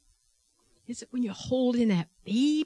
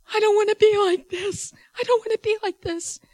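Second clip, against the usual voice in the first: high, loud imitated wail; acted anguished cry, pitched way up; retold crying; 12 semitones higher, 5 dB louder than usual. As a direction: plead as the distraught patient. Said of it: "she's crying".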